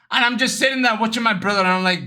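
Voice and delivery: ordinary talk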